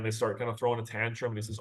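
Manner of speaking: usual speaking voice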